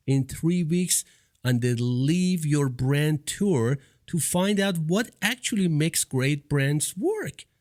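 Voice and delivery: bright, lifted announcer read over music; upbeat guest introduction; podcast intro read. Acted. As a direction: introduce the guest like a show opener. Reads host intro over music.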